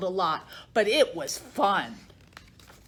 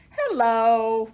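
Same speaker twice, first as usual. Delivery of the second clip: George's silly hello; 4 dB louder than usual. Acted. George's surprise sound instead of bark.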